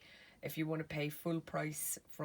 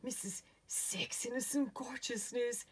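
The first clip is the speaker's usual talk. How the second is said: breathy vintage purr; 4 semitones higher than usual. Acted. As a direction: purr it like an old film siren. Glamorous persona greeting viewers.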